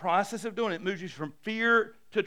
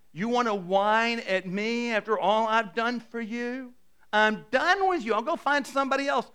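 raised, fed-up rebuke; acted indignation; 5 semitones higher, 3 dB louder than usual. Preacher as a fed-up God scolding whiners, indignant.

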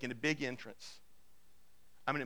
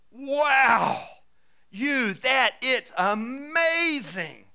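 loud, higher, drawn-out amazement; awestruck wow; 8 semitones higher, 8 dB louder, much slower than usual. Retold or imitated. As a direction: gush like an impressed crowd. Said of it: "everybody will stand back going".

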